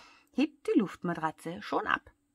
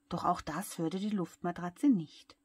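ordinary delivery, nothing marked

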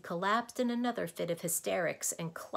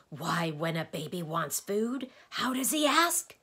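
louder question climbing high; rousing challenge; 3 semitones higher, 7 dB louder than usual. A narrator's reading. Doctor fires quiz after roaring.

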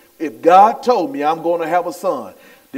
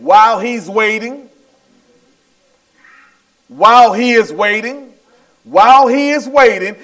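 high, ringing preacher's delivery; fervent preaching; 5 semitones higher, 5 dB louder than usual. Real, not acted. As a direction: stretch the key phrases and repeat them with rising fervor. Preaches at full force.

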